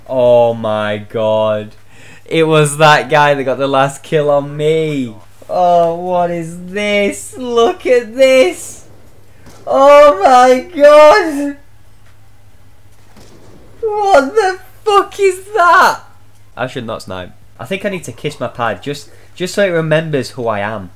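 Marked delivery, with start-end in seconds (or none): 5.5-16.0 high drawn-out disbelief. Real, not acted. Gamer baffled by enemy.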